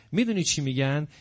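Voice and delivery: normal talking voice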